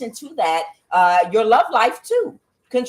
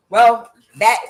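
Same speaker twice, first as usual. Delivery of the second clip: loud attempt at a Trump accent; half-formed Trump impersonation; Trump impression; 2 semitones higher, 8 dB louder than usual. Speaker trying out a Trump accent, loud mock Trump. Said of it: "trying to do my Trump accent".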